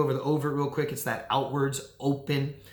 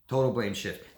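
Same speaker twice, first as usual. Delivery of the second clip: ordinary talk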